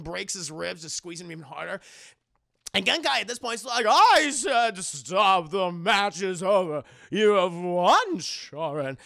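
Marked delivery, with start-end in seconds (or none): 3.9-9.0 slow, loud, drawn-out order; stern command. Retold or imitated. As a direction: bellow the ruling slowly. Master orders the fight stopped.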